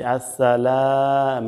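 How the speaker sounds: long held "laa" in "আসসালাম"; one-alif stretch. Shown as a demonstration. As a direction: stretch the last syllable of "আসসালাম". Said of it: "এক আলিফ টান আছে". (লা-তে এক আলিফ টান)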